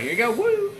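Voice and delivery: high-pitched whooping send-off; mock whoop. Tattooist acting out being thrown in, high mock whoop. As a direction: whoop as if tossing someone in.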